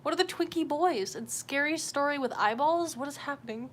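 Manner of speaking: ordinary talk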